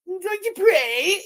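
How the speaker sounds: high, slow sing-song mockery of her; mocking quote. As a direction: parrot her words to ridicule them. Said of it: "and she thinks".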